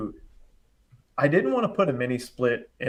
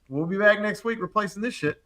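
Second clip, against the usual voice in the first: sceptical cousin; 8 semitones higher than usual. Retold or imitated. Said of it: "that's exactly his exact words".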